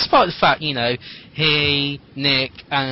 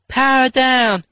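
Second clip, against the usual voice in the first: much higher, louder mock hero call; hammed-up chorus; 10 semitones higher, 6 dB louder, slower than usual. [name] and [name] powering down, mock heroic chorus. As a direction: shout it like a team of Rangers. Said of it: "repeated [name] and [name]".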